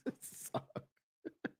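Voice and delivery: breathless whisper through a laugh; barely voiced, still laughing; soft whispered it's all good. Real, not acted. Laughing reassurance.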